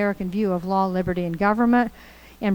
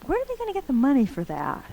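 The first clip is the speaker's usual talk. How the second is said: pointed question; 6 semitones higher than usual. Retold or imitated. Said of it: "he says".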